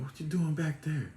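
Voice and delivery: mock guard questioning; retold guard's challenge.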